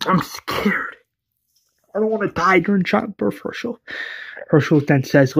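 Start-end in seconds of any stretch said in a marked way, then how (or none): 0.1-1.0 hushed, frightened confession; scared whisper. Acted. Lori admits fear, whispered. 1.9-3.8 a hint of a frightened mother, pitched up; lightly voiced frightened mother pleading with the doctor; scared Lori.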